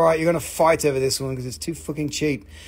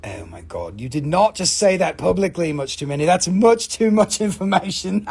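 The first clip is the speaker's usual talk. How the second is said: ordinary delivery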